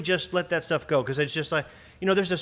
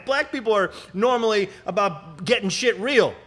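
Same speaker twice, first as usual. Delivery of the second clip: higher, louder urging; impassioned plea; 8 semitones higher, 3 dB louder than usual. Real, not acted. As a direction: plead with them, voice rising. Impassioned appeal.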